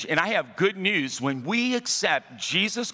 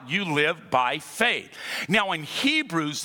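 usual speaking voice

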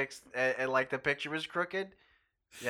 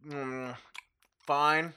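hum, then force the word out; mock mouth full. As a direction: pretend to answer round a huge mouthful. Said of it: "just shoveled a heap of food into my mouth".

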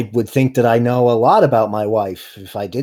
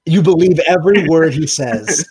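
louder, higher, slightly hammed-up verdict; emphatic pronouncement; 5 semitones higher, 6 dB louder than usual. Game master reveals the insight result, mock emphatic. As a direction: declare it loudly with relish.

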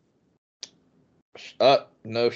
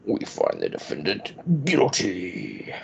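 judge voice; much slower than usual. Pretend. Mock courtroom verdict.

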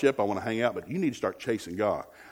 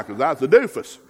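loud, rising preacher build; preaching; 3 semitones higher, 6 dB louder than usual. Acted. Preacher telling the church his testimony, full preaching force. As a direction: preach it out over the organ.

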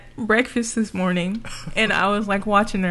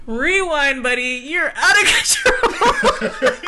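words broken up by laughter; laughing through the quote; 6 semitones higher, 3 dB louder, much slower than usual. Retold or imitated. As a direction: laugh while acting out the friends' warning. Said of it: "They were like".

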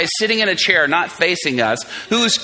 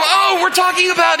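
high guy voice; 10 semitones higher than usual. Retold or imitated.

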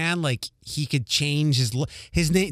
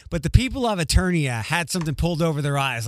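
normal talking voice

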